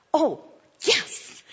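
high-pitched, acted dawning realization; as if it only just dawned on you; sudden realization. Walker reminded by the Lord to pray, acted realization.

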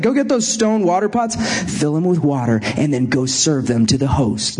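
low, flat orders to the servants; mock Jesus command. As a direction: hand out the orders deadpan. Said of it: "No, he didn't say it like that".

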